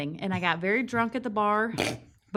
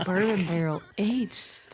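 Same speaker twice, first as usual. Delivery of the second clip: breathy, hushed beer description; sultry whisper; 2 semitones lower, slower than usual. Pretend. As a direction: whisper it like a seductive ad. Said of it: "That's my sultry voice".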